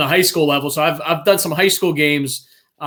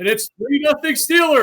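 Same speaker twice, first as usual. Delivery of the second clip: Bill Hillgrove impression; 10 semitones higher than usual. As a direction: belt it out like a play-by-play man calling the kick. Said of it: "my old Bill Hillgrove impression".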